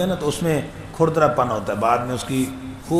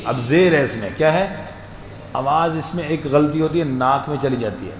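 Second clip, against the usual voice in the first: normal talking voice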